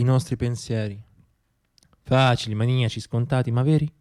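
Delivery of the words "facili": ordinary talk, nothing marked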